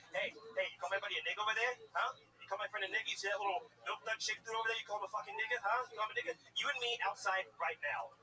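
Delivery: a riled-up buddy picking a bar fight; mock angry tough guy. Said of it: "very angry".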